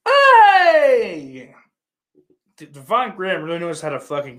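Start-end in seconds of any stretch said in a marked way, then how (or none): 0.1-1.5 a high, loud far-off yell; sudden shout. Acted. Host suddenly yells at his viewers, loud and high. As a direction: bark it out at the room.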